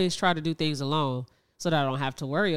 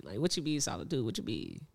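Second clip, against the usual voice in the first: put-on confused teen voice; 6 semitones lower, 13 dB quieter than usual. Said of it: "'Cause motherfuckers don't".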